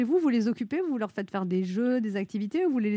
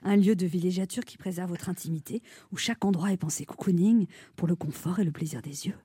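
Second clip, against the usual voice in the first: low, soft seductive purr; breathy sensual ad voice; 5 semitones lower, 3 dB quieter than usual. Acted. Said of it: "je prends une voix sensuelle".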